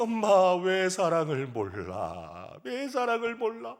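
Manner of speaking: put-on loud, high child's tantrum wail, drawn out; acted tearful wailing. Frustrated boy crying to his mother who cannot understand, wailing loudly. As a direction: build from a drawn-out whine to a hammed-up crying shout. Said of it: "철수가 막 울기를 시작".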